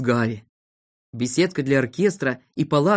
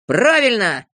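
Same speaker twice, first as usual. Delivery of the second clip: loud, higher cry of agreement; raised, emphatic voice; eager shout; 5 semitones higher, 5 dB louder, slower than usual. A narrator's reading. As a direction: shout your hearty approval. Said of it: "закричал Авоська".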